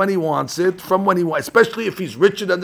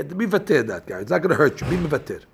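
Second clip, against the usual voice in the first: normal talking voice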